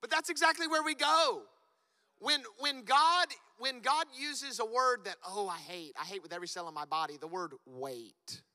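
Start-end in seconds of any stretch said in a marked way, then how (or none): 0.0-5.0 much higher, much louder preaching cry; preaching shout. Real, not acted. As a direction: call it out to the whole room. Preacher drives point home.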